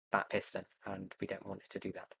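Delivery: ordinary talk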